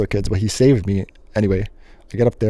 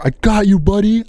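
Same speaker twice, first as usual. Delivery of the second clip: rescuer; 10 semitones higher, 4 dB louder than usual. Retold or imitated. Rescuer grabs his arm.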